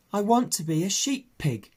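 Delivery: put-on a young pig stating his dream; the pig telling the sheep his wish; earnest piglet. Babe declares.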